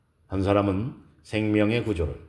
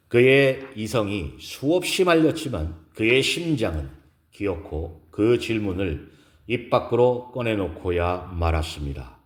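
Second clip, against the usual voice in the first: usual speaking voice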